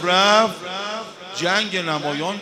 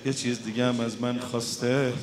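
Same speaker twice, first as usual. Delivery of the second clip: gentle uncle; 4 semitones lower, 5 dB quieter than usual. Acted. Imam Hussein gently tells Qasim his father's wish, soft and low. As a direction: break the news tenderly and hushed.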